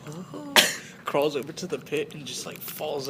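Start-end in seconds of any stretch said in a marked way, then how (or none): 0.1-0.5 faint, high "oh"; weak moan. Acted. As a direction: let out a small, soft, high groan. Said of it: "He's like".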